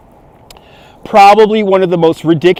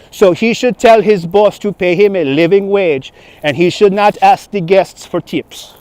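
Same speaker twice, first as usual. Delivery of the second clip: put-on slow, higher-pitched French accent; French tourist accent; 4 semitones higher than usual. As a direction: make a pompous announcement in a mock French accent. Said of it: "I had a French tourist".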